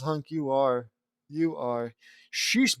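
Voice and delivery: ordinary talk, nothing marked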